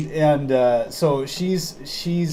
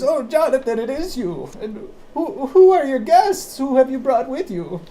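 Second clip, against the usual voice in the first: put-on high, delighted old lady voice; a sweet little old woman greeting her husband; 11 semitones higher than usual.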